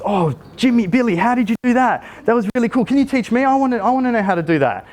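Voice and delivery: high, gushing parent praise; hammed-up enthusiasm. Shown as a demonstration. Parent showing a child huge interest, gushing and high. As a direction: overplay how impressed you are. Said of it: "really ham up on that".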